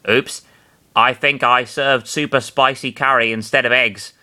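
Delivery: ordinary delivery, nothing marked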